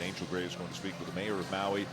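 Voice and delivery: ordinary delivery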